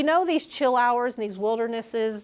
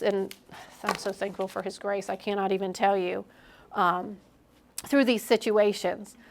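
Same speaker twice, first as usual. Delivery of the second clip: heartfelt gratitude; 2 semitones lower, 6 dB quieter than usual. Real, not acted. Sincere thanks to God.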